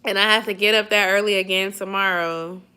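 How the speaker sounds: ordinary talk, nothing marked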